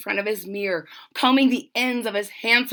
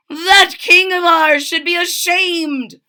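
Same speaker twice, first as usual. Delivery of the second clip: loud, high indignant cry; outraged trumpeter; 8 semitones higher, 8 dB louder than usual. Acted. Trumpeter furious at the king, loud outraged cry. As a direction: scold the king at full voice.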